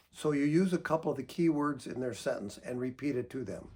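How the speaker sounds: ordinary talk, nothing marked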